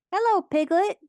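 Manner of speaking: very high, loud little greeting; excited squeak. A narrator's reading. Young Roo greeting Piglet at the party, excited squeak. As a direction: pipe up in a tiny squeak. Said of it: "he squeaked".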